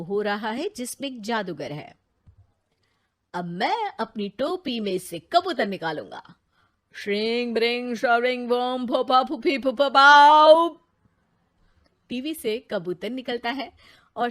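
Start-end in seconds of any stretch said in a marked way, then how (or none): 3.3-10.7 a showman magician chanting his spell; stage magician. Acted. Magician on TV chanting a spell, showy. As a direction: roll out the magic words like a stage conjurer.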